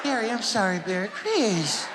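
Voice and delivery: [name] impression. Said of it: "And Mike was like".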